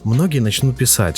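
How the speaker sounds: usual speaking voice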